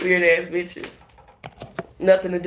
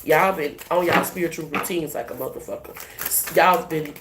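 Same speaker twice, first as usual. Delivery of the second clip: ordinary talk, nothing marked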